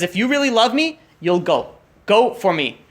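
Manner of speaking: retold earnest plea, pitched high at first; urgent plea. Husband urging his wife to go, pleading. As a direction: press the appeal, voice lifting.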